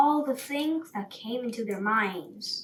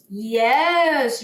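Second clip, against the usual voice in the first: loud lion roar of a word; 7 dB louder than usual. Acted. Woken lion answers the sparrow with a roar. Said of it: "roared the lion".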